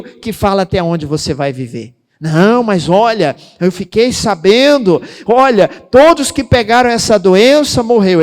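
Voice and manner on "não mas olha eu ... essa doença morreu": imitando o fofoqueiro, alto e alarmado; fofoqueiro alarmista. Retold or imitated. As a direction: avisa a notícia ruim em tom alto e dramático. Pregador imita fofoqueiro pessimista. (imitação alta de quem espalha notícia ruim)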